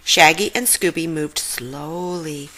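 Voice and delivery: usual speaking voice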